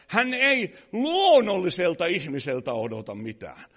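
loud, driving sermon voice; preaching at full force. Acted. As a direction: hammer it home to the pews. Fiery preaching to congregation.

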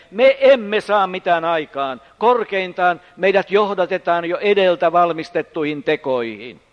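loud, ringing pulpit voice; full-force preaching. Acted. Preacher proclaiming loudly to the congregation, full force. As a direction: drive it home to the congregation.

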